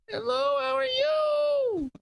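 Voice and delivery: put-on very high, slow baby talk; squeaky, stretched-out baby voice. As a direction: coo it like a grown man talking for his baby. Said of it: "the baby talk".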